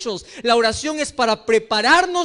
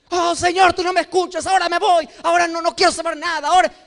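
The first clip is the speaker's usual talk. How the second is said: petulant sulk; 6 semitones higher, faster than usual. Retold or imitated. Sulking believer complaining to God.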